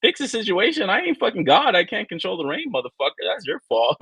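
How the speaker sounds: high-pitched indignant rant, easing down; retold angry retort.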